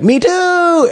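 high, drawn-out mock enthusiasm; eager copycat. Mimicking a comedian copying his peer, high mock-eager cry. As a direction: squeal it like an over-eager imitator.

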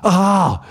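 sharp startled "Ah!", high and loud; imitating his own startled cry; sudden scream. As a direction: jolt out a scream as a flashback hits. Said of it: "exakt så skriker jag till så här".

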